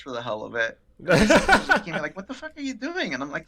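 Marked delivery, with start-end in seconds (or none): 2.1-3.1 mock incredulous. Supervisor watching agent talk tea, mock incredulous. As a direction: imitate an aghast onlooker. Same acted baffled supervisor.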